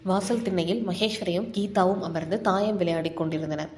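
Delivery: plain reading voice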